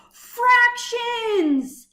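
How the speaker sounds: high, loud cry of dismay-delight; excited outburst. Acted. Boy sees fractions in his lunch, high loud outburst. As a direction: blurt it out like a sudden discovery. Lunch turns into a math problem.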